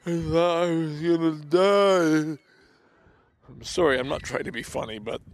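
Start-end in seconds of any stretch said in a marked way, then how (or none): 0.0-2.4 high, loud, slow choked words; gagged speech. Retold or imitated. Patient choking out his fear to the dentist. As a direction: force the words out past a gag. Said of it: "gagged as it was".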